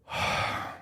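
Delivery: soft, long breathy sigh. Retold or imitated. Agent before revealing the title, long sigh. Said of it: "long sigh, he goes".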